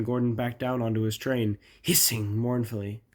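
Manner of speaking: ordinary delivery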